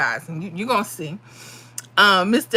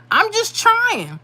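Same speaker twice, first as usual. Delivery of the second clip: high plea; 11 semitones higher, slower than usual. Real, not acted. Pleading amid teasing.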